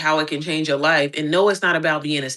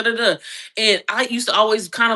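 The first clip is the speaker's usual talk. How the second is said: ordinary talk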